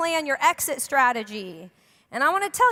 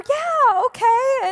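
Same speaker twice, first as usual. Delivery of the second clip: high, louder grudging yeah okay; mock reluctant agreement; 10 semitones higher, 4 dB louder, much slower than usual. Pastor retelling her reply to visitors, grudging. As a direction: agree while clearly unconvinced.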